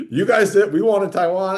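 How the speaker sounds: high, loud mock protest; mock eager China. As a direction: pitch it up loudly as a joking me-too demand. Mock quote of China wanting Taiwan.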